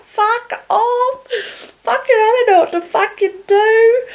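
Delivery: very high, tearful wail; squeaky, sobbing mock despair; imitated crying breakdown. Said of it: "break it down, like".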